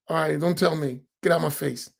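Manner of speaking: low, soft dismissive mutter; mock brush-off, dropped low and soft. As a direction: wave him off under your breath. Imitated brush-off.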